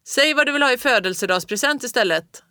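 loud call over the snowstorm. A narrator's reading. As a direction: shout back against the storm. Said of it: "ropade pappa".

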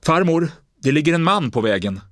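high, loud cry to grandmother; desperate scream. Acted. Boy screams a lie to his grandmother, high and loud. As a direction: blurt the lie out in a shout. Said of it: "skrek han till henne".

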